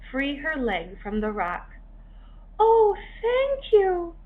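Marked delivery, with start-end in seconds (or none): 2.6-4.2 high, slow relieved thanks; grateful little crab. A narrator's reading. Rescued hermit crab thanking Henry, relieved. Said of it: "she said".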